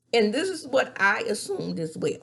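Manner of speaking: ordinary talk, nothing marked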